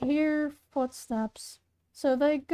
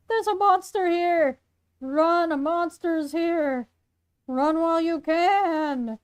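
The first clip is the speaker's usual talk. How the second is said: raised, drawn-out alarm call; called-out warning; 4 semitones higher, slower than usual. Acted. Trio warning people to run from the monster, calling out. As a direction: warn the crowd, stretching the words. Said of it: "They start calling out a warning".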